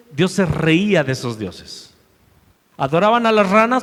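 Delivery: usual speaking voice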